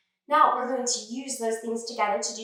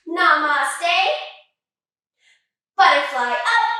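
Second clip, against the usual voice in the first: calling each pose loudly; loud called-out pose names; 7 dB louder, much slower than usual. Acted. Teacher calling sun salutation poses for kids, loud. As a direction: lead the class through each pose with a big call.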